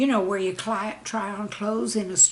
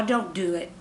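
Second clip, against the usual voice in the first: ordinary delivery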